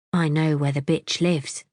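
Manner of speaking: ordinary delivery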